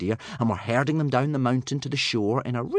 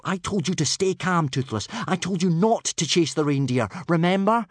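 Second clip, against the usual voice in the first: scolding; 8 semitones higher than usual. A narrator's reading. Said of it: "scolded Hiccup".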